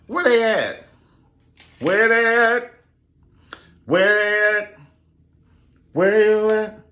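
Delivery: high, loud repeated call; loud high sing-song calling. Real, not acted. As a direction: holler for the missing stars. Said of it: "calling out the old actresses".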